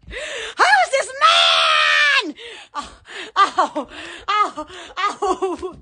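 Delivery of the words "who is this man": excited gush. Real, not acted. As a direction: stretch it out in delight. Gushing over a man.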